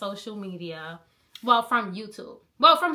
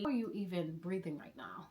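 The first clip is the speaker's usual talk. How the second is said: ordinary delivery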